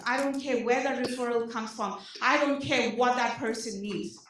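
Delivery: ordinary talk, nothing marked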